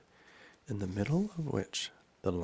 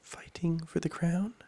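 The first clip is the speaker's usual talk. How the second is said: put-on high-pitched girl's question; Alice asking; 10 semitones higher, 4 dB louder than usual. A narrator's reading. Alice asks in story.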